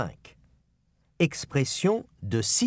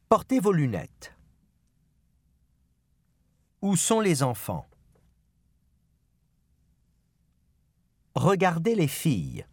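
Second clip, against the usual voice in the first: five even beats with the stress on the final syllable; model phrases for repetition. Shown as a demonstration. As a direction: clear drill delivery with the weight on the last syllable. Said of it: "en faisant bien attention au rythme et à l'accent tonique".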